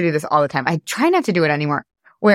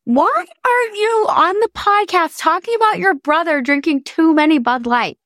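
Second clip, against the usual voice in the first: much higher scolding mom voice; imitating mom; 11 semitones higher than usual. Host imitating her mom scolding her, much higher. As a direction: mimic mom chiding her on the podcast. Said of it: "I can already hear my mom being like".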